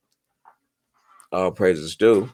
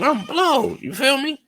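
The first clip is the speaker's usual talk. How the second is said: amazed; 4 dB louder than usual. Real, not acted. Excited disbelief.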